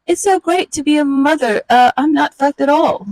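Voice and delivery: a mother faking delight about her newborn; mom's thoughts. Acted. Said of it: "She thinks".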